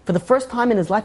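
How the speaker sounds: ordinary delivery, nothing marked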